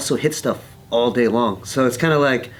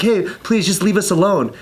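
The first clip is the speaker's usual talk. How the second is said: higher-pitched pleading; retold plea; 8 semitones higher, 3 dB louder than usual. Aggressor's friend to the man, pleading. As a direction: lightly act out a plea.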